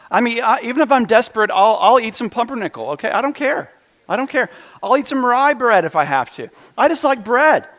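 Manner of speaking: high and loud, gushing over bread; excited bread rant. Real, not acted. Pastor gushing to the congregation about bread, excited and loud. Preacher confessing his love of bread.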